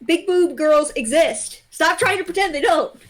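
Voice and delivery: ordinary delivery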